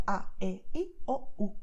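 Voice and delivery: A, E, I, O, U as simple Spanish vowels; pure Spanish vowels. Shown as a demonstration. Teacher showing learners the five Spanish vowels, pure vowel sounds. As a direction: give A, E, I, O, U one pure sound each. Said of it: "you do not do that in Spanish".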